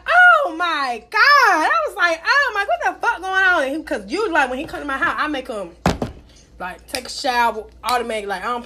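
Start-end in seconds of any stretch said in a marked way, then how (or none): 0.1-3.6 shocked outcry. Retold or imitated. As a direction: gasp it out in horror. Retold shock, crying out.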